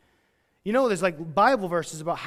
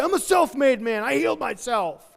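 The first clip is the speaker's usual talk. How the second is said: higher, louder, puffed-up mock boast; boastful; 5 semitones higher, 5 dB louder than usual. Pretend Naaman boasting he healed himself, mock bragging. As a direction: brag like a proud man claiming all credit. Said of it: "turn around and say".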